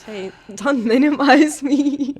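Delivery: slow, laughing mock protest through giggles. Real, not acted. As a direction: laugh while teasing back, pretending offence. Teasing protest about paying.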